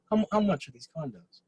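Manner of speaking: a hint of a smooth, self-assured buyer, lightly voiced; magnetic rich man. Shown as a demonstration. Imitating the rich buyer asking about condos in Marbella, lightly magnetic. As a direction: ask it like a man who owns the room. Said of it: "I'm trying to be as magnetic as this guy".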